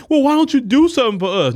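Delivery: high, pleading whine; mock pleading voter. Voter begging a politician, high whiny plea. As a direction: beg like a helpless voter.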